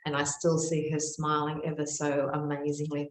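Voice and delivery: ordinary talk, nothing marked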